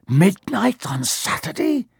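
slow, high, hoarse disbelief; hoarse alarm. A narrator's reading. Ron bolt upright in a sweat, hoarse. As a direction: croak it out in horror. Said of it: "he said in a hoarse voice".

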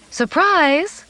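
high, joyful announcement; excited cry. A narrator's reading. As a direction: burst in with a big surprise. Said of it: "cried Mom".

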